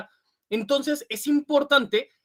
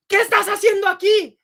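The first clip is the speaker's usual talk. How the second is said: confronting; 10 semitones higher, 7 dB louder than usual. Retold or imitated. Person confronting someone, acted quote.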